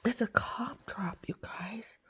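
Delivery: hushed whisper to the pets. Real, not acted. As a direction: let it fall to a breath.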